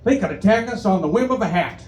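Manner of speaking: a hint of a bumbling president; Bush impression. Comic quoting Bush's blunder, light mock voice. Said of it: "he'd say things like".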